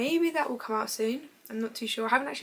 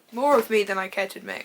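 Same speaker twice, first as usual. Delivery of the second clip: loud, exasperated self-correction while reading; emphatic correction; 8 dB louder than usual. Real, not acted. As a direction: hit "More of me" hard, then read on. Louder corrected rereading of the quote.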